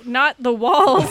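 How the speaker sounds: loud laughing protest. Real, not acted.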